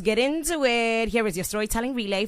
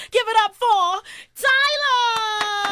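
hyped announcer; 12 semitones higher, 4 dB louder, much slower than usual. Acted. Announcer hyping award show.

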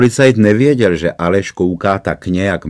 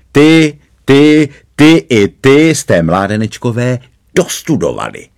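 sly gloating; 6 semitones higher, 5 dB louder than usual. A narrator's reading. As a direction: gloat over each one in turn. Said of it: "Poťouchle se usmál". (poťouchlé, škodolibé vyhrožování)

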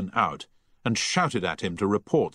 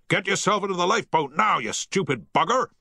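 much higher, fast furious shout; angry shout; 11 semitones higher than usual. A narrator's reading. Captain yells at Mister Liu to get in the lifeboat, angry. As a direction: bark at the praying man. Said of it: "shouted Havens".

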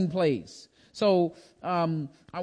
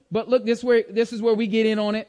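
usual speaking voice

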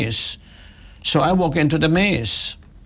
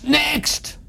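sudden high shout, much higher; teller screaming next; 11 semitones higher than usual. Retold or imitated. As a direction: bark it out across the hall like an impatient teller. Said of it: "somebody on the right screams".

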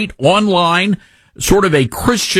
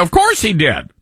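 ordinary delivery

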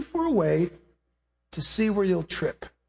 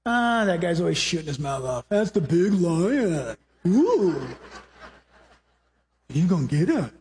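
mother zebra; 4 semitones lower than usual. Acted. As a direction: shrug it off like a tired mom.

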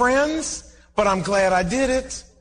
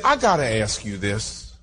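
clerk voice; 10 semitones lower than usual. Retold or imitated. Clerk asks, voiced low.

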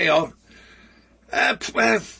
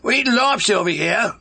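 badly faked Massachusetts accent, dropping the R in lobster; hammed-up Massachusetts local. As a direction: put on a clumsy Massachusetts accent. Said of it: "that's a bad accent".

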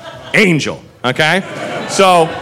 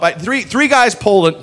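ordinary delivery

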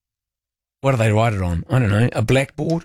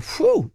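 relieved whew. Retold or imitated. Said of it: "you think".

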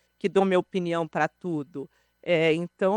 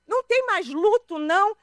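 indignant; 11 semitones higher, 5 dB louder than usual. Retold or imitated. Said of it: "Aí".

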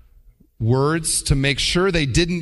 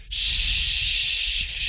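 whispered shoot; 3 dB quieter than usual. Shown as a demonstration. Demo of a mild euphemism.

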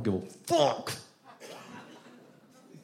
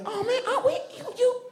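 squealing very high, surprised and laughing; mock Southern yelp of surprise. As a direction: pitch way up like startled Southerners. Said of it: "their voices go up really high".

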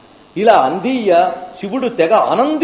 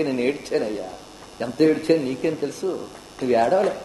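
much softer, heartfelt; quiet confession; 5 semitones lower, 7 dB quieter than usual. Acted. Ramakrishna quietly telling a seeker he wept for God. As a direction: admit it gently, almost in tears. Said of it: "Ramakrishna Paramahamsa చెప్పేట".

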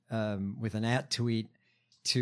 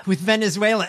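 a touch higher, louder parody after a sigh; mock imitation; 7 semitones higher, 7 dB louder, faster than usual. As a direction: echo her line sarcastically. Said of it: "mocks her line after sighing".

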